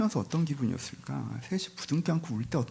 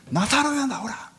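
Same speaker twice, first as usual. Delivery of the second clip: very high, ringing acted shout; loud calling out; 13 semitones higher, 4 dB louder, slower than usual. Jesus calls Lazarus out of the tomb, loud high shout. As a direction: call the dead man out with a big raised cry. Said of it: "큰 소리로 외칩니다".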